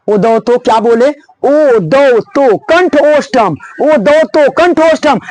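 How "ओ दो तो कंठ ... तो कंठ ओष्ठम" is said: drawn-out rote chant of the sutra; slow sutra recitation. Shown as a demonstration. Teacher reciting the Sanskrit sutra slowly so students memorize it. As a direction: recite it slowly for the class to repeat. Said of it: "देखो ना, देखो यहाँ देखो".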